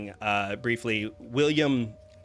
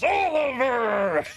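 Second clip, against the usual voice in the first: long, much higher and louder cry of the son's name; joyful greeting; 14 semitones higher, 6 dB louder, slower than usual. Acted. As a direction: hug him and shout his name with joy. Father greets his son joyfully.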